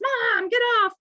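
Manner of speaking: put-on high, loud, whiny kid's call; kids yelling. Kids running out to mom on TikTok live, high loud whine. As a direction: shout it like a kid nagging mom.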